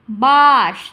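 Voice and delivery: বাঁশ loud and long, one unbroken sound. Shown as a demonstration. Teacher explaining the sound comes out at once, বাঁশ in one go. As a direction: let বাঁশ out as a single held syllable. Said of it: "একেবারে আমাদের আওয়াজটা বেরিয়ে আসছে".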